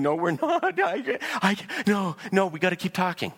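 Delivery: high, rushed plea with laughs; cling on, don't let them hang up; frantic pleading. Acted. Partner begging the other not to end the call, frantic and high.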